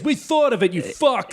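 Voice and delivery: high-pitched, hammed-up boastful announcer; mock hype presenter. Presenter taking credit before the crowd, mock boasting. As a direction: crow at the crowd in parody. Parodying the swearing stage presenter.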